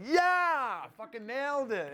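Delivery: high gleeful yeah; triumphant. Retold or imitated. Actor celebrating a great take, high gleeful cheer. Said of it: "I was like".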